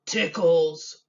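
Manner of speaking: a lightly voiced monster, loud; a hint of a hungry monster; hungry thing voice. A narrator's reading. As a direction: announce the food like a creature. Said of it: "answered the hungry thing".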